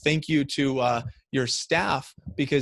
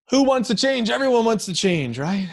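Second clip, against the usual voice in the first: rousing call; 9 semitones higher, 6 dB louder than usual. Acted. Rallying the audience, rhetorical question.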